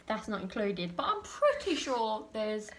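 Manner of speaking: usual speaking voice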